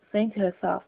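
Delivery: ordinary delivery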